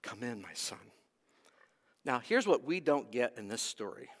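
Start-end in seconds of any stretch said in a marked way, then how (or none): 0.0-0.9 softer, lower coaxing; father softly begging his son; gentle plea. Acted. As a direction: plead with him tenderly. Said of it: "begins to plead with him".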